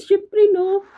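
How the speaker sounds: can't hide the excitement; giddy. Real, not acted. Excited about getting the money. (kilig na kilig)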